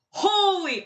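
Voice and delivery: high, loud awed exclamation; excited gasp. Retold or imitated. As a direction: burst out in awe. Reacting to the music.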